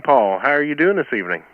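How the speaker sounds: normal talking voice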